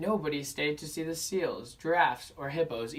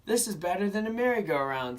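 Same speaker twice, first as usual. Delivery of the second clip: put-on children's voice; 6 semitones higher, 4 dB louder than usual. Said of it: "said the children".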